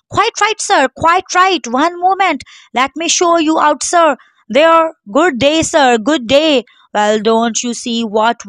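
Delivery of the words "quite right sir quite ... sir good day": high, eager bowing-out of a rich guest; fawning shopkeeper. Acted. Tailor bows millionaire out.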